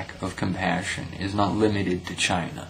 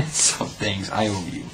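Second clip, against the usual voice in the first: ordinary talk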